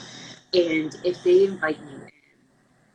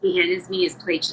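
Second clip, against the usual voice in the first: usual speaking voice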